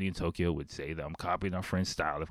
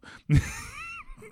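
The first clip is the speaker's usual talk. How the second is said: laughing. Real, not acted. Laughing at his memory.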